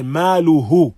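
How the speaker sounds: ما له with the ه cut short, no lengthening; clipped, no elongation. Shown as a demonstration. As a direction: say ما له quickly with a bare short ه. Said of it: "we do not say".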